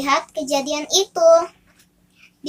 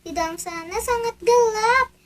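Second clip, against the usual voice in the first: excited; 7 semitones higher than usual. Acted.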